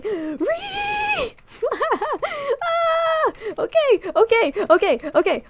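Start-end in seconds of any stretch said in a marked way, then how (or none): 0.0-1.3 sudden high shriek of relief; high shrieking squeal. Real, not acted. 2.6-3.3 laughing high shriek. Real, not acted.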